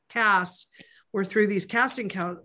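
normal talking voice

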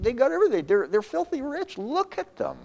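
very high-pitched envious complaint; envious marveling. Acted. Preacher acting Asaph eyeing the rich, envious.

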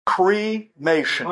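Cremation drawn out slowly and firmly; slow deliberate punchline. Acted. Daughter's final word to her mother, slow and firm.

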